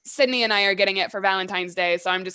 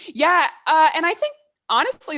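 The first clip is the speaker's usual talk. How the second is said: excited; 8 semitones higher, 7 dB louder than usual. Real, not acted. Excited burst after laugh.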